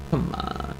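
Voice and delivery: ordinary talk, nothing marked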